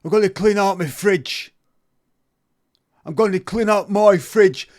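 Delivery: loud, high mock English accent. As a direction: keep hamming the English accent. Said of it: "No, I'll stick with it".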